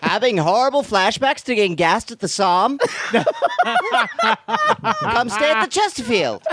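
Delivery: put-on high, laughing old-time ad voice; mid-Atlantic accent. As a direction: pitch it like a 1920s radio spot. Old-timey ad bit.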